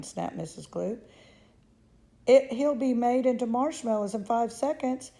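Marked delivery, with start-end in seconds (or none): 2.3-5.1 loud, high outcry; mother horrified at marshmallows, loud and high; horrified outburst. Acted. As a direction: cry it out aghast. Mother shrieks in horror.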